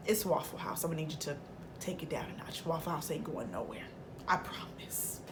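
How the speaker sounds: low, very soft mock-patient voice; quiet, condescending reassurance; hushed calming down. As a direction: drop to a near whisper to settle a friend. Retold calming of impatient friend.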